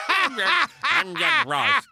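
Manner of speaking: slow, high-pitched puppet-like impression; Bob Hope. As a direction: draw it out high like a comedian's puppet. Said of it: "It's like a Bob Hope puppet".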